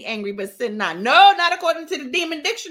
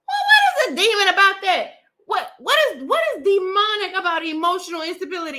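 louder, higher laughing disbelief; incredulous outburst; 6 semitones higher, 4 dB louder than usual. Real, not acted. Vlogger mocking the book, loud and incredulous. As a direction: demand an answer, half laughing. Outraged reading reaction.

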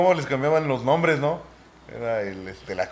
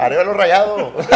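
put-on Acapulco accent; 5 semitones higher, 9 dB louder than usual. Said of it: "Ese fue como de, de Acapulco".

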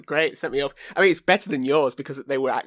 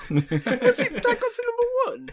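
disbelief; slower than usual. Real, not acted. Amazed at the chart fact.

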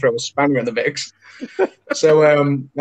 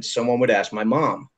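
American accent; 3 semitones lower, 4 dB quieter than usual. Shown as a demonstration. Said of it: "if that was an American".

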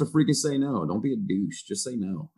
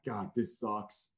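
worn-out groan; 10 dB quieter, much slower than usual. Retold or imitated. Quote at finish line.

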